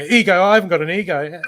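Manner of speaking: scoffing imitation of someone denying they have an ego; mock denial. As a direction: toss it off like a know-it-all brushing the idea away. Mocking dismissive people.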